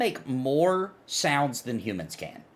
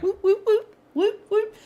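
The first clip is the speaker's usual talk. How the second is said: very high squeaky whoops; guinea pig voice; 4 dB louder than usual. Retold or imitated. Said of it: "guinea pigs are like".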